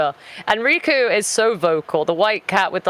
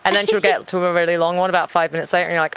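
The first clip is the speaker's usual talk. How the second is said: ordinary talk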